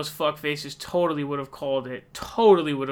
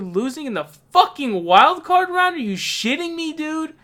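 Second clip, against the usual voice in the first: outraged disbelief; 11 semitones higher, 5 dB louder than usual. Real, not acted. Fan ranting at playoff exit.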